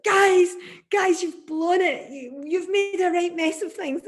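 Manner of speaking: high, loud exasperated telling-off; scolding. Retold or imitated. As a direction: tell the band off after the gig. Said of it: "he was like".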